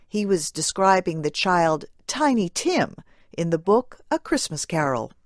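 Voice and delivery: ordinary talk, nothing marked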